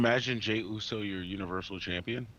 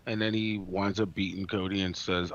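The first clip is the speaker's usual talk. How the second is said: ordinary talk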